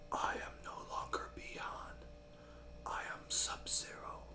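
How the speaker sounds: menacing whisper impression; whispered villain. Villain renouncing his old name to his master, whispered. Voicing Sub-Zero's declaration.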